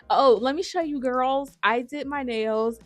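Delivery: eager show-off. Real, not acted. Showing off nails.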